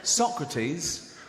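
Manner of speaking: usual speaking voice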